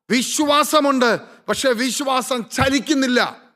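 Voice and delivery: rising fervour, pressing the congregation; fervent preaching. Acted. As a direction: drive it home from the pulpit. Preaching climax.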